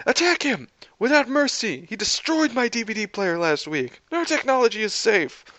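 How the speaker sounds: high-pitched mad villain ranting; vengeful cry. A narrator's reading. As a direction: order the attack in a high, theatrical yell. Tony sets robots on Steve.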